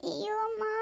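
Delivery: barely audible moan of pain; faint pained moan. Acted. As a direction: let it slip out weakly, hurting. Writhing in pain in room.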